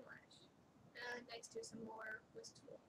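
soft whispered report. Real, not acted. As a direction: whisper it secretly. Child whispers.

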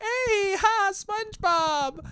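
put-on high, drawn-out delighted kid voice; excited kids. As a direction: greet him like thrilled little kids. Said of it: "they just see, like".

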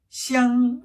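"샹" drawn out on a level pitch; first tone. Shown as a demonstration. Teacher modelling the second syllable, "샹" long level tone. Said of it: "냉장고라는 단어 일 성".

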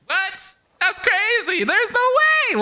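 high, incredulous retold reaction; shocked disbelief. Replaying a creator's claim, mock shocked and high. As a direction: act out hearing something unbelievable. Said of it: "I was like".

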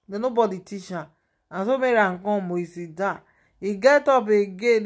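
ordinary talk, nothing marked

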